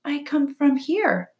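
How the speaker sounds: high, slow, even robot voice; robot character. A narrator's reading. Robot explains herself to the weasel, slow and high. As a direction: answer deliberately, word by word.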